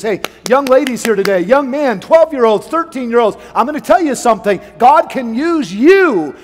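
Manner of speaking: loud, high preaching voice; full-force preaching. Acted. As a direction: call out to the teenagers. Preaching to teenagers.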